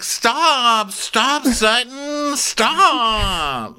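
very high, drawn-out whine; mock pleading. [name] begging Sutton to stop, mock pleading. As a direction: wail each plea in hammed-up dismay.

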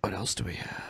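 whispered aside to self. Real, not acted. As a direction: breathe it, no voice. Muttered aside.

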